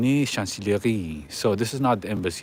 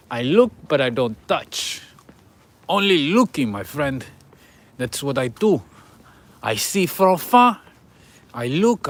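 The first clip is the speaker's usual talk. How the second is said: higher, louder hammed-up Russian accent; mock Russian accent; 4 semitones higher, 4 dB louder than usual. Vlogger joking to viewers about Novichok, hammed-up Russian accent. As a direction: play a cartoon Russian tourist in a Russian accent. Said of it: "that's a very convincing accent".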